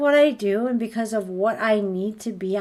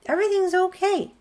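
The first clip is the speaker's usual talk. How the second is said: lightly voiced doctor, pitched up and airy; breezy doctor voice; 9 semitones higher than usual. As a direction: mimic a doctor waving it all off, high and light. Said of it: "But if they say".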